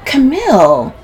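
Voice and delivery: high, loud scolding call; scandalized cry; shocked exclamation. A narrator's reading. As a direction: exclaim the name in reproach. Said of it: "Sylvie exclaims".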